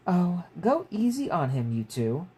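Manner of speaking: gentle mother. Acted. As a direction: soften them like a kind mother. Mother defends her son.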